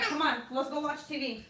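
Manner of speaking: ordinary delivery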